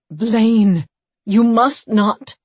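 loud, high pleading cry; urgent shout. A narrator's reading. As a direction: shout a desperate warning. Old priest cries out a warning.

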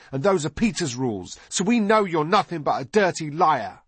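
put-on high, emphatic boy's accusation; accusing boy. A narrator's reading. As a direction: accuse him as an indignant boy. Narrator voices Nod accusing Nip.

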